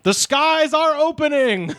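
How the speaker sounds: high, drawn-out mock proclamation; imitated street screaming. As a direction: cry it out slowly like a street prophet. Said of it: "screaming from the streets, quote".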